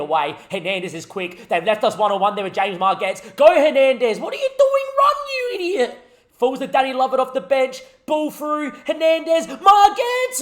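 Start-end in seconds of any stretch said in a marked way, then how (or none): 3.4-4.2 yelling him on, high and loud; roaring encouragement at a breakaway; excited shout. Real, not acted. Live game, striker through on goal. 4.2-6.0 shrieking high and loud in frustration; furious high-pitched shout at the screen; exasperated yell. Real, not acted. Striker slows up on a breakaway. 8.0-10.4 calling names high and loud; commentator-style roar at a chance; excited shout. Real, not acted. Live chance in front of goal.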